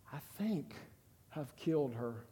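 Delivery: broken whisper-soft. Retold or imitated. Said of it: "kept stuttering the words".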